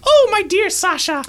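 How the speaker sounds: put-on high, fond old maid voice; maid's voice. Maid greeting her lost cat, high and fond. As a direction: coo over the cat as the maid.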